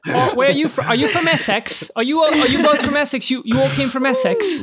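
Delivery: high-pitched English crowd-work patter; mock British accent. Comic asking audience members about Essex, high British accent. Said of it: "You do a little British accent".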